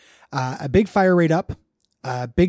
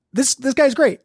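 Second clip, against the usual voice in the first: ordinary delivery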